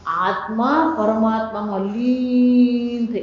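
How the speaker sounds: very slow, stretched holy-man voice; drawn-out mahatma tone. Retold or imitated. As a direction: stretch the words like a saint in trance. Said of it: "લાંબુ બોલે ને". (ધીમું, લંબાવેલું મહાત્મા જેવું બોલવું)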